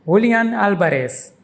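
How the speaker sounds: Julian Alvarez as hoo-lee-AHN AL-ba-res in one breath; Spanish pronunciation. Shown as a demonstration. Teacher says the full name smoothly, the Spanish way. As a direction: Julian with h and last-syllable stress, Alvarez with b and s. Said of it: "is pronounced".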